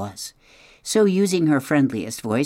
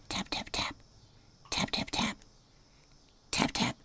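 whispered tapping; 13 dB quieter than usual. A narrator's reading. Egg tapping sound read out.

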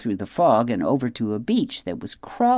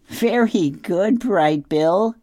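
put-on higher, louder chirpy greeting from an old goose; cheery old goose; 8 semitones higher, 4 dB louder, much slower than usual. A narrator's reading. Old goose Loud Wing greeting Roz and her gosling, high and cheery. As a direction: sing out hello like a fussy old bird.

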